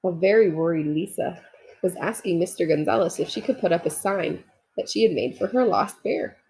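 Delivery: ordinary delivery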